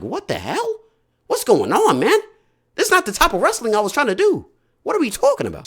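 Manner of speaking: high protest. Retold or imitated. Retold outraged protest.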